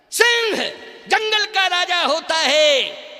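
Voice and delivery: loud, high, drawn-out declaration; ringing proclamation. Real, not acted. Preacher proclaims the lion king of the jungle, ringing proclamation. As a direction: proclaim it at full voice, stretching the words.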